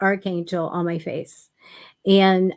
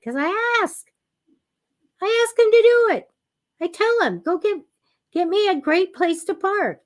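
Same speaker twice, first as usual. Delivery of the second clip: much higher, bright sing-song answer; perky retold answer; 10 semitones higher than usual. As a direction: chirp it back like it's obvious. Her own retold answer to sister.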